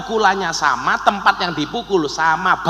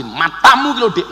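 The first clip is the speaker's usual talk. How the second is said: mock angry. Husband praised by preacher turning on wife, mock angry. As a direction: snap it like a cross spouse.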